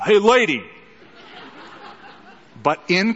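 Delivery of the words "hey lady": brash New York "Hey, lady" called out loud and high; New Yorker. Retold or imitated. Preacher mimicking a New Yorker hailing a woman, brash New York call. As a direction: holler it like a New York cabbie. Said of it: "like a New Yorker kind of".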